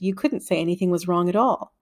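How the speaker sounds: ordinary delivery, nothing marked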